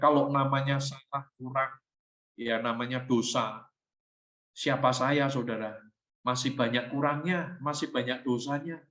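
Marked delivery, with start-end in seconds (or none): none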